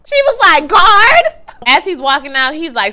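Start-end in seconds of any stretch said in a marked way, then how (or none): 0.0-1.2 much higher and louder, laughing; laughing shout. Real, not acted. Viewer retelling Serena calling the guard, through loud laughter. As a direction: crack up as you call it out.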